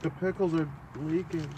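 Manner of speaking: soft, small-voiced remark; 조용한 말투. Quiet take, then repeated high.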